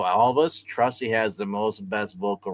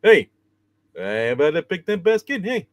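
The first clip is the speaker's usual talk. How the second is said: higher, louder cartoon bear voice; Yogi Bear voice; 6 semitones higher, 7 dB louder than usual. Host does a cartoon bear voice, higher and louder. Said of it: "I make him sound like Barney".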